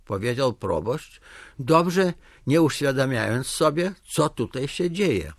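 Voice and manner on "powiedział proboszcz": usual speaking voice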